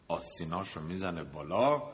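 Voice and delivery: ordinary delivery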